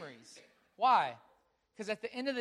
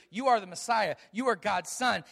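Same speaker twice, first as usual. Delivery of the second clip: loud, eager blurt; 8 dB louder than usual. Retold or imitated. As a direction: blurt it out before thinking. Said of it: "Simon Peter says really quickly".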